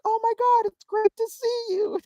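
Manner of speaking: very high, drawn-out mimicked gush; sing-out high, stretched welcome; gushing greeting. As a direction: imitate an overjoyed woman greeting someone. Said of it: "She just instinctually was like".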